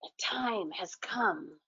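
soft slow whisper. Acted. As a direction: whisper into the reindeer's ear. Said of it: "whispered into her fur-tipped ear".

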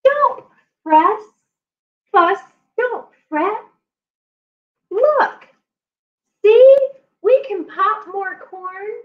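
high little squeaking mouse voice; squeaky mouse. Acted. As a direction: comfort him in a tiny squeak. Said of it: "Mouse squeaks".

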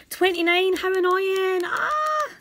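high, sudden cry of annoyance; exasperated yelp at the near miss; frustrated yelp. Real, not acted.

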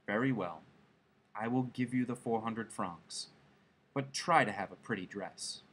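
resigned husband. A narrator's reading. Husband agrees to give up his savings, quietly resigned. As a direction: concede quietly, a little pained.

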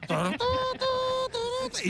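high, slow imitated radio noise; broken radio sound. Narrator imitates his broken car radio after starting the engine, high and slow. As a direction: sing the glitching car radio, far up. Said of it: "se encendió la radio y sonaba".

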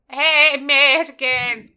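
loud, high, stretched-out hello; overdone greeting. Acted. May rehearsing small talk for a party, overloud greeting. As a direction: boom it out as an overeager introduction.

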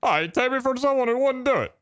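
high-pitched joke New Yorker; mock New York accent. Gamer bantering with a friend over a dare, mock New York accent. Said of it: "as a New Yorker, I feel offended".

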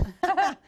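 real amused laugh at the joke; a genuine laugh breaking out; burst of laughter. Real, not acted. Guest laughs at Brady Bunch joke.

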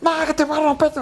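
angry referee taunting player, high mock voice. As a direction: sneer it up high like a bully. Referee threatening.

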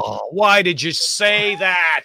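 exaggerated "how could you" wail; mock dismay. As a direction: groan it out slowly in playful protest. Jingle stuck in his head.

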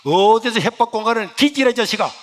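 mock-angry older brother brushing off a threat; brother cursing. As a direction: snap at the kid brother with contempt. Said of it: "막 욕을 하는 거야".

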